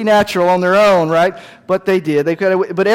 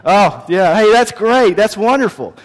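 mock enthusiasm; 4 semitones higher, 4 dB louder than usual. Dad humoring kids.

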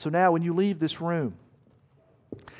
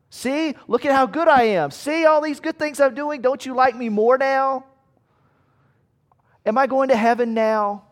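high, pleading show-off voice; needy eager believer; 9 semitones higher, 5 dB louder than usual. Retold or imitated. A believer begging God to notice good deeds, high and pleading. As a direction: beg God for approval like an eager child.

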